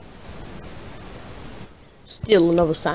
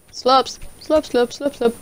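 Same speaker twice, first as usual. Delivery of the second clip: silly slurping; spoken slurp noises; 4 semitones higher, slower than usual. Pretend. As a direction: say slurp like a goofy sound effect. Goose drinking, spoken slurps.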